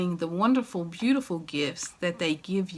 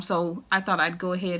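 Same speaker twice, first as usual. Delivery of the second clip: normal talking voice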